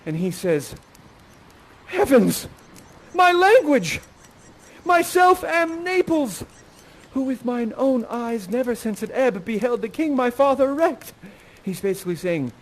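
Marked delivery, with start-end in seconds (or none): 1.9-11.1 amazed prince. Acted. Ferdinand amazed by her language.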